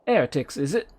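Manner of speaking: put-on low-class accent with dropped H's; wobbly low-class character accent. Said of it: "accents I just devolve into".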